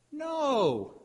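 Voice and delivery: put-on high, drawn-out toddler refusal; small defiant child piping up; high little kid voice, sliding down; little boy's voice. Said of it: "Andy's little voice spoke up from behind me".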